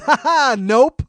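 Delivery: re-enacted laugh in someone's face, loud and high; big derisive ha-ha into a no; mocking laugh. Buyer retelling how he refused, mocking laugh. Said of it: "I laughed in his face".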